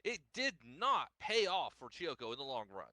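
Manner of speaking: ordinary delivery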